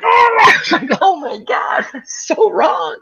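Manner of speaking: high laughing shriek of disbelief; shocked cry. Real, not acted. Recalling the razor scene to the chat, high shocked cry.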